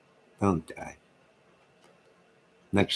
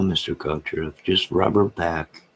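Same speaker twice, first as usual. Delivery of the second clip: ordinary talk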